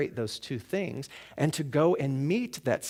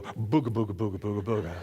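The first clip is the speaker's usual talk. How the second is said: low, rolling mumbo-jumbo chant; mock swami incantation; 4 semitones lower, faster than usual. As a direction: play a swami casting a comic healing spell. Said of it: "there would be some swami And he would go".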